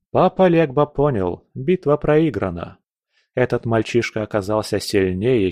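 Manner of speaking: ordinary delivery, nothing marked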